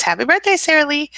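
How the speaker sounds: high, loud sing-song greeting; cheerful birthday call; excited birthday wish. Real, not acted. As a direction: chirp the wish brightly.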